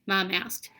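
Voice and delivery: ordinary delivery, nothing marked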